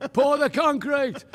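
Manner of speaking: laughing through the words, high; chuckling as you say it. Real, not acted. Laughing at own story.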